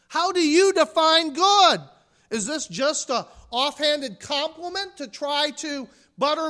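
loud challenging preaching. Acted. Preacher voicing Jesus to the congregation, loud and challenging. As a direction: challenge them hard.

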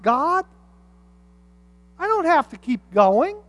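a believer begging God to stop, whining; whiny plea to God. Retold or imitated. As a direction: whine at God that you've done enough. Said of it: "we want God to stop".

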